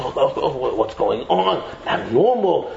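low, acted murmur of alarm; disapproving onlooker. Onlookers watching an excited learner, low disapproval. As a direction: drop low like a scandalized bystander.

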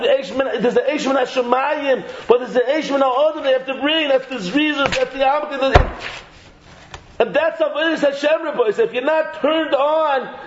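ordinary delivery

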